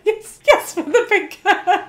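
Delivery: high, loud, laughing yes yes; giddy cheer. Retold or imitated. Cheering when a colleague recalls her pink hair, high giddy delight. Retold joyful agreement.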